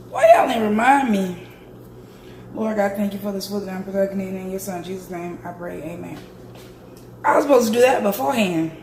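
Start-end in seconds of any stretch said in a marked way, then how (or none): 2.5-6.2 quick, soft monotone prayer, trailing quieter; murmured grace. Real, not acted. Thanking God for the food, murmured quick prayer. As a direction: say grace quickly under your breath. Says grace before eating.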